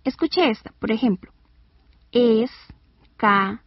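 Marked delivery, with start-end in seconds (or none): 2.1-3.6 es-ca-le-ra, each syllable slow and separate; slow teaching demonstration of "Escalera" in syllables. Shown as a demonstration. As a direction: break "Escalera" into four drawn-out syllables.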